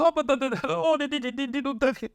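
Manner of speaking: high-pitched mock chef chatter; hammed-up chef voice; mock hibachi chef. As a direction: imitate the chef's patter up high. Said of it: "the dude come back there flipping".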